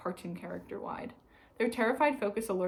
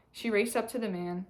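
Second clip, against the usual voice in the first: ordinary delivery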